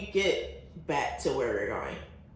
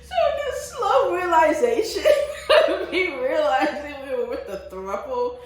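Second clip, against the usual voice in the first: talking through high, loud laughter; laughing; 13 semitones higher, 5 dB louder than usual. Real, not acted. Recalling the throuple on the boat, laughing through the words. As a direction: squeal the words out while cracking up. Telling an awkward boat memory, cracking up.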